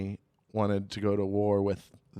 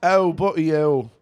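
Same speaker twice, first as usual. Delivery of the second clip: loud, higher, botched British accent; attempted British accent; 5 semitones higher, 6 dB louder, much slower than usual. Shown as a demonstration. Comic on the spot showing his best accent, attempted British. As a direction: have a half-hearted go at British. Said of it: "Is that British?".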